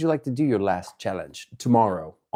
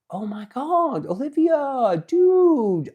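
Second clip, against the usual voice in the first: excited squeal; 14 semitones higher, much slower than usual. Real, not acted. Streamer spotting a fan in chat, excited squeal. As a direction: squeal her name in delight.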